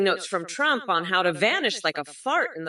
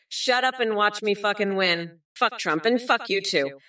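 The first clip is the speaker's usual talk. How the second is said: ordinary talk, nothing marked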